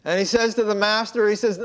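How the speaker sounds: usual speaking voice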